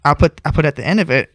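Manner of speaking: usual speaking voice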